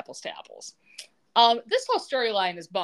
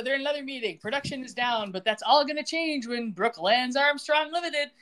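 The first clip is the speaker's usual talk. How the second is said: usual speaking voice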